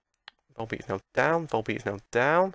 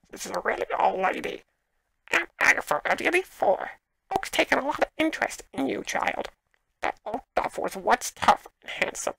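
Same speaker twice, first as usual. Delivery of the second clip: put-on high, loud, strained old-crone voice; hammed-up old lady sliding into a creature voice; old lady turning Gollum; 12 semitones higher, 4 dB louder than usual. Gamer voices Agatha, eighty-four, badly faked old lady turning Gollum. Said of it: "went from old lady to Gollum".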